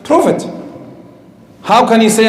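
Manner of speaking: ordinary delivery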